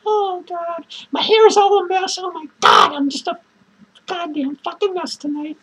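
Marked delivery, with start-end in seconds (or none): none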